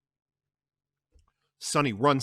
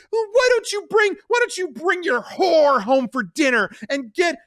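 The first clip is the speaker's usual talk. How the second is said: much higher, loud shriek; screaming accusation; 10 dB louder than usual. Acted. Connie screams at Carlo.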